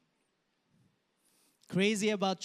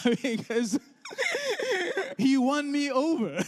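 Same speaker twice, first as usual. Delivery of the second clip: laughing through the words, a little higher; 12 semitones higher, 4 dB louder than usual. Real, not acted. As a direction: chuckle as you admit defeat. Laughs at giving in.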